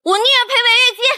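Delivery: very high-pitched angry retort; indignant, high-voiced defiance; defiant outburst. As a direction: fire back, pitch climbing to the top. Quarrel, threatens to move out.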